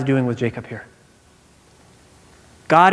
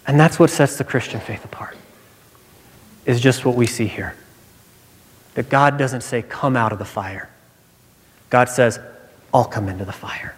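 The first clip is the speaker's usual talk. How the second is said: hushed; 4 semitones lower, 3 dB quieter than usual. Real, not acted. Quiet climax of sermon.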